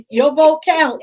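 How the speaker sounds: loud and high, hitting the word vote hard; emphatic insistence. Real, not acted. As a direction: insist firmly that "vote" matters. Insists people should vote.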